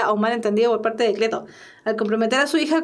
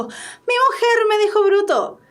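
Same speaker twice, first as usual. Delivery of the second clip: high, drawn-up declaration of triumph; triumphant; 8 semitones higher than usual. Acted. Teacher showing Cleto straightening up, triumphant tone. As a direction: announce it as a victory. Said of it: "dice con tono de triunfo".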